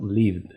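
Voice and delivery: lived as one syllable ending in voiced v-d; D sonora final. Shown as a demonstration. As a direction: close lived on a buzzing D. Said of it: "¿cómo voy a pronunciar?". (lived en una sílaba, con D vibrante)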